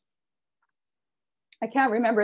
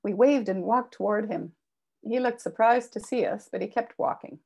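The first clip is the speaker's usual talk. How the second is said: plain reading voice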